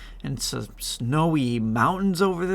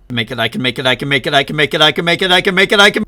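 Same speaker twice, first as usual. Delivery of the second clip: building panic; 3 semitones higher, 9 dB louder, much faster than usual. Real, not acted. Desperate jump, building chant.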